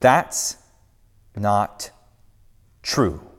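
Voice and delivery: each word set apart, firmly. A narrator's reading. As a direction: calmly refuse the lie, word by word. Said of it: "the voice says firmly".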